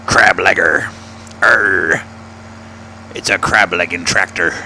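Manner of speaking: put-on pirate growl with an Arr. Streamer joking about his crab legger tractor, mock pirate voice.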